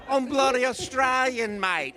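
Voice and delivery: loud, drawn-out broad Australian indignation; pronounced Australian accent. Retold or imitated. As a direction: imitate an affronted Australian, thickly accented. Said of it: "She was the most pronounced accent".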